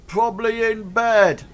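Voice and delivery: high, loud call; shouted heckle. Acted. Another ruffian capping the joke, high loud shout. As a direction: shout the jibe from the back. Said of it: "Another one shouts".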